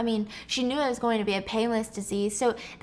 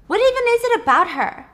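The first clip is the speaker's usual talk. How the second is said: desperate; 12 semitones higher, 8 dB louder than usual. Retold or imitated. Sarah pleads with Peter.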